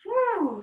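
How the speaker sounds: high, falling "whew"; exhaled whew. Shown as a demonstration. Teacher shakes out bee breath, high whew. Releasing after breathing exercise.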